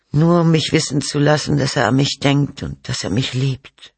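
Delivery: high, thin woman's voice; frail, sorrowful mother. Acted. Mother tells detectives of her absent son, wistful and high.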